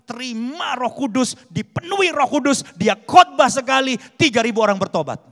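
high, loud, climbing sermon climax; fervent preaching. Real, not acted. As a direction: fire off each phrase with mounting force. Preaches at full force. (berkhotbah berapi-api, makin lantang)

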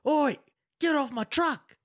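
high-pitched shout of an annoyed truck owner; old bloke. Retold or imitated. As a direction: imitate an old man yelling someone off his truck. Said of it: "you hear this, um, old bloke go".

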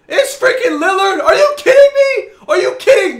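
high, very loud incredulous yelling; outraged shout. Real, not acted. Gamer getting the wrong Lillard, outraged shout. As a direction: yell in real outrage.

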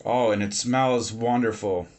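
ordinary delivery

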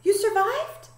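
very high, incredulous question; amazed cry. A narrator's reading. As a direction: let the pitch leap in wonder. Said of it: "cried Stella Luna".

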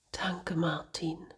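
slow, very soft whisper; soft whispered thanks. Acted. Angel thanks Martin in the car, slow soft whisper. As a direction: breathe the thanks out, tired. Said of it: "sagte Angel".

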